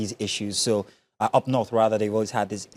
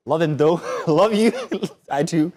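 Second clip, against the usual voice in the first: laughing; 6 semitones higher, 7 dB louder than usual. Real, not acted. Laughing declaration of fondness.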